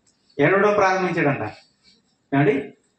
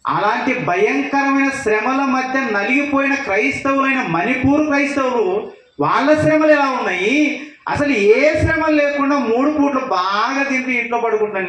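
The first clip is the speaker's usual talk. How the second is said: fervent preaching; 8 semitones higher than usual. Real, not acted. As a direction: preach it hard at them, voice raised high. Preacher rebukes comfortable Christians.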